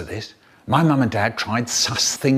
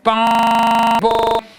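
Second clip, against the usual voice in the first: bell bong; 9 semitones higher, 5 dB louder than usual. Retold or imitated. Bell imitated.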